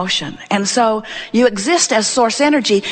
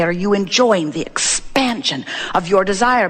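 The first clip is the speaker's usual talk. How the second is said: measured question. Shown as a demonstration. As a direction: draw out the question, pausing inside EXPANSION. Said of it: "That's what we mean when we say".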